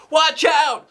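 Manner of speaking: high, loud cry of alarm; shouted warning. Acted. A kid warning the falling teacher, shouting. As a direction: yell to warn him.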